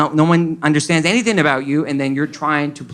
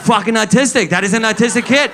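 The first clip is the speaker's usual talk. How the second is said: mock shout; 8 semitones higher, 5 dB louder than usual. Classmates' loud verdict.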